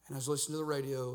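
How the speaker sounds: ordinary delivery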